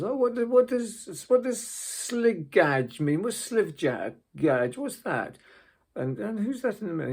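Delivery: a hint of a confused old lady, slowing over the word. Old lady puzzling over a badge name, lightly mimicked. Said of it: "an old lady of seventy".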